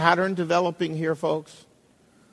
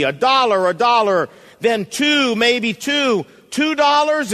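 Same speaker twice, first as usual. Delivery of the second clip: loud, higher auctioneer's call; auctioneer crying out; 6 semitones higher, 4 dB louder, slower than usual. Acted. Auctioneer calling for bids on the violin, loud. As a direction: hawk the old violin to the room. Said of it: "he cried".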